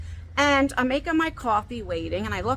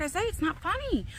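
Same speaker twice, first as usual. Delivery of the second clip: higher, softer exasperated plea; retold scolding; 6 semitones higher, 5 dB quieter than usual. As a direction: protest at being laughed at. Retold scolding of laughing son.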